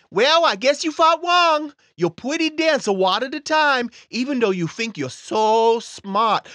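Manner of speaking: put-on a bratty child sneering; little boy voice. Timmy voice insults host.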